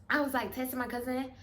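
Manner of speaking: ordinary delivery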